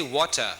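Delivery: "water" with a crisp, clear T; British "water". Shown as a demonstration. Teacher contrasting accents for a class, "water" with a true British T. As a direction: sound the T in "water" cleanly, British style. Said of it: "That's British".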